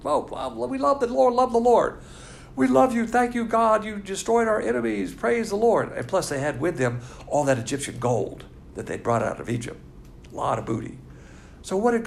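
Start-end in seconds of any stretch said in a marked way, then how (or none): 0.0-5.9 mock joyful praise. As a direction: ham up their happy praise of the Lord.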